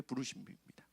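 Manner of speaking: whispered emphasis. Real, not acted. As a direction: drop to a breathy whisper on the last word. Sermon climax word dropped to whisper.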